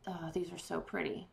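breathy whispered awe at the pearls; soft whispered admiration. Real, not acted. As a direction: whisper it like a secret delight. Admiring the pearls under her breath.

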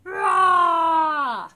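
huge shouted roar; very loud, long animal roar; lion roar. Pretend. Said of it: "Roar like a lion".

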